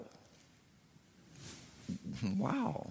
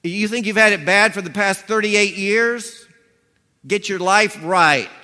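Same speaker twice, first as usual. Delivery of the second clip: fierce preaching; 7 semitones higher, 9 dB louder than usual. Acted. Pastor voicing Jesus warning the healed man, loud and stern. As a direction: drive the warning home.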